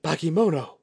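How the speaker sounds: high, loud startled gasp of the word; gasped in shock. A narrator's reading. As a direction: blurt it out in sudden alarm. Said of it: "roughly gasped".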